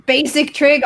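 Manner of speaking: ordinary talk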